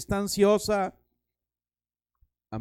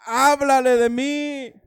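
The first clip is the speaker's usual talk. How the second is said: louder, slow grudging "háblale de mí"; exasperated plea; 3 semitones higher, 7 dB louder, slower than usual. Retold or imitated. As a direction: push it out in reluctant protest. Reluctant believer pleading with God. (súplica exasperada, lenta y más fuerte)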